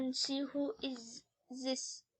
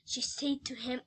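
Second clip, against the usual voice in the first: usual speaking voice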